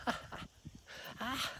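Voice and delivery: high, breathy "ah, ah, ah" laugh; imitated laugh. Acting out suddenly bursting into laughter, imitated laugh. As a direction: act out a sudden fit of giggles. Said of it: "I just start laughing".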